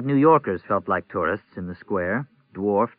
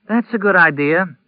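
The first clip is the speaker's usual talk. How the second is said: relieved; 9 semitones higher, 8 dB louder than usual. A narrator's reading. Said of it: "said with obvious relief".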